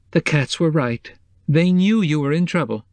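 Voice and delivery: put-on anxious older woman, relieved at last; worried housekeeper. A narrator's reading. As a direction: she was up all night fretting, let it tumble out. Mrs Cobb voiced by narrator.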